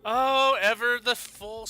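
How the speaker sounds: normal talking voice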